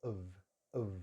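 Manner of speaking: weak "of", "uhv"; reduced "of" with buzzing V; schwa and V. Shown as a demonstration. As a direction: end "of" on a voiced V. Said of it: "the F is a V sound".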